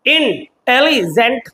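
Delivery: Intelligent drawn out slowly, syllable by syllable, rising at the end; slow syllable demo. Shown as a demonstration. Teacher counting the syllables of intelligent for students, slowly and clearly. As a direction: stretch out intelligent slowly so each syllable is heard. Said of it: "तीन words है".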